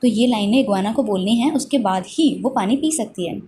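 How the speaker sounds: ordinary delivery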